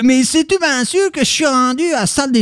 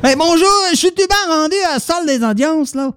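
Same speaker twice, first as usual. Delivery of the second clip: put-on Quebec accent; 5 semitones higher than usual.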